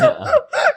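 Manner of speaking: high wailing fake sob; high mock sob laugh. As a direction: burst into a hammy sob. Sister mocking mom crying.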